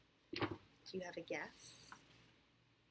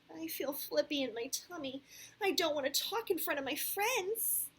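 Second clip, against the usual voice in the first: put-on higher, small child voice; nervous little boy; 10 semitones higher, 8 dB louder than usual. A narrator's reading. Sam admits nerves to Missus Stewart, nervous child voice. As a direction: lift it into a reluctant child's plea.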